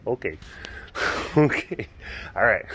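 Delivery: ordinary delivery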